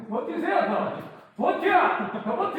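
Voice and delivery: high, loud push to resist; urgent coaching shout. Real, not acted. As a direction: drive them to hold out harder. Urging client to resist push.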